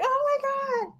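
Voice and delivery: very high, soft, breathless oh my God; sister's amazed gasp. Retold or imitated. Imitating her sister seeing the bouquet photo, high and amazed. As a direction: gasp like you're about to tear up. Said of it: "and she's like".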